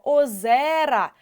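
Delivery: ОЗЕРА with the stressed Е held long; наголос на Е. Shown as a demonstration. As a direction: lean clearly on the Е in ОЗЕРА. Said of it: "ми чітко чуємо наголошений е". (ОЗЕРА з виразно наголошеним Е)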